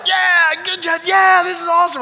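high, loud cheering; high-fiving son, loud excited cheer. Retold or imitated. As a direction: whoop it up like a kid after a great shot. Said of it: "so excited".

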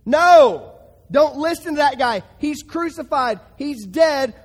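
loud sharp "No!" then forceful rebuke; emphatic shout. Acted. Preacher telling the congregation to refuse temptation, loud rebuke.

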